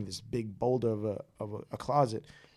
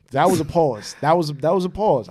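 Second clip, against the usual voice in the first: emphatic joking; 5 semitones higher, 11 dB louder than usual. Real, not acted. Joking emphasis on his pause.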